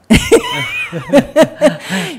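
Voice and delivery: a bright burst of laughter; high laugh. Real, not acted. Laughing while telling of sister's fake test.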